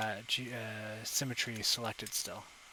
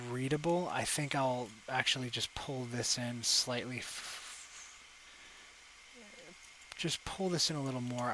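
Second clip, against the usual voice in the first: ordinary delivery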